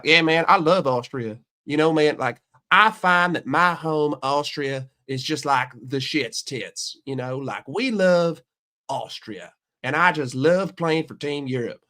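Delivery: mock Southern drawl; Sepp Straka. Golfer professing love for Austria, in a mock Southern drawl. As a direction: put on a Southern drawl, mocking. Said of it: "he's speaking with a Southern drawl".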